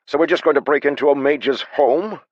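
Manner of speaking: high, loud, alarmed disbelief; panicked. A narrator's reading. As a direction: recoil in panic, voice rising. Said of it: "Haber said, taking a step back".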